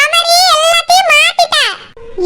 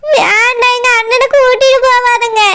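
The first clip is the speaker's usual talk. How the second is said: ordinary talk, nothing marked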